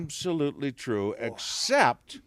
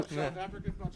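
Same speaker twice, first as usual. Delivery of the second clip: South African accent; 9 semitones higher, 7 dB quieter, faster than usual. Shown as a demonstration. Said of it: "I can do a South African accent".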